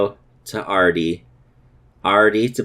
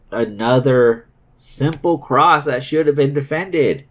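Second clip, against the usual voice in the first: normal talking voice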